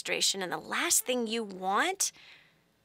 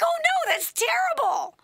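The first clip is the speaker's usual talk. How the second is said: high, hammed-up wail of pity; sorry for him. Mother suddenly pitying her frustrated child, high mock-weepy dismay. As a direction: cry out in dramatized sympathy. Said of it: "I felt so sorry for him".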